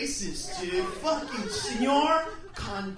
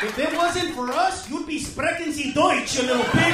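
loud, higher angry yell; furious shout; 5 semitones higher, 12 dB louder than usual. Retold or imitated. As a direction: blast him at full volume. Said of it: "I was like".

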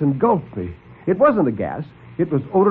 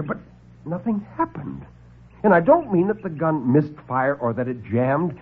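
ordinary talk, nothing marked

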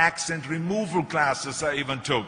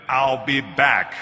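slow, louder, deadpan "I'll be back"; Terminator line; 6 dB louder, much slower than usual. Retold or imitated. Quoting his famous Terminator line to the audience, slow and deadpan. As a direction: give it like the movie machine. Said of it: "when I did Terminator".

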